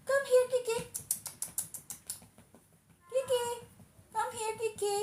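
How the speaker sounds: sing-song high-pitched call to a kitty; pet talk. Real, not acted. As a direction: coax a pet over in a tiny high voice. Coaxing her cat on a live.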